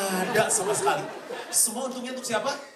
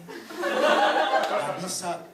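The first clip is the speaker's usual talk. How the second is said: mock stubborn whine; 9 semitones higher, 5 dB louder, much slower than usual. Stubborn bride refusing to forgive.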